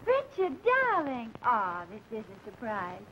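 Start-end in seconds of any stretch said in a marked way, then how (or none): 0.1-1.3 sugary calling out. Acted. Calls out sweetly to fiance.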